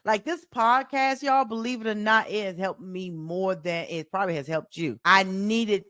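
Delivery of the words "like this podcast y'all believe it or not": ordinary talk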